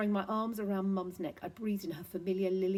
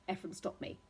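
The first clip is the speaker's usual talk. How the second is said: usual speaking voice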